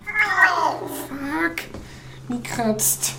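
sharp yelps of pain; scratched by the cat, yelping in pain; pained outcry. Real, not acted. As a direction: cry out as the claws dig in.

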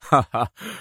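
short mocking laugh; sarcastic laugh. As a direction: laugh scornfully. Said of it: "أطلق أدهم ضحكة ساخرة عالية".

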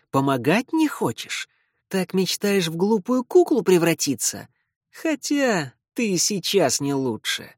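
sneering vampire hiss; hissing feral vampire. Acted. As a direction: spit the taunts with contempt. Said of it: "зашипел Лео".

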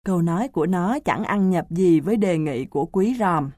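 usual speaking voice

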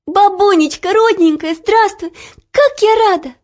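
high, loud joyful greeting; overjoyed young girl. Acted. Olya hugs grandma joyfully.

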